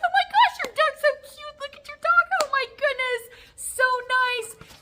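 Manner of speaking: put-on very high, loud baby-talk squeal; gushing visitor. Visitor walks in and sees the dog, high gushing squeal. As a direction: fawn over a cute dog in a high squeal. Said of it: "I'm using that tone of voice".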